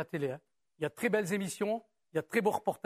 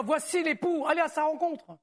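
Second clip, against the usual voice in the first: raised, higher-pitched announcing call; crying out; 6 semitones higher than usual. A narrator's reading. Reading of the bridegroom's arrival at midnight, calling out. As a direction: read it as a mild cry in the night. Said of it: "on cria".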